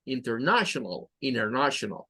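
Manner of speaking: international with sh and schwas; English pronunciation demo. Shown as a demonstration. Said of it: "vean que en esta palabra".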